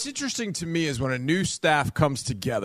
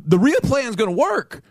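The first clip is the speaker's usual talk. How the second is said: excited realization; 10 semitones higher, 4 dB louder, slower than usual. Retold or imitated. Retold inner conviction, high pitch.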